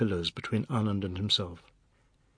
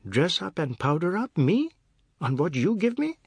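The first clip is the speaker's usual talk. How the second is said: Me jumps high and loud; outraged wife protesting; indignant disbelief; 6 semitones higher, 3 dB louder than usual. A narrator's reading.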